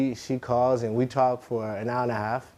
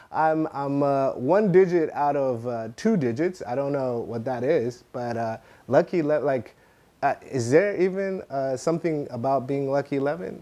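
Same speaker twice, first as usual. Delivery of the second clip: normal talking voice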